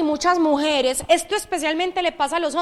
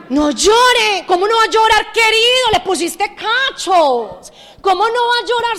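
raised, loud incredulous retort; indignant retort; 7 semitones higher, 5 dB louder than usual. Acted. Therapist scolding the unfaithful husband, loud and indignant. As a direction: throw his words back at him, outraged. Mocking reply to cheating husband.

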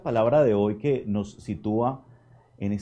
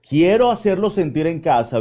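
ordinary talk

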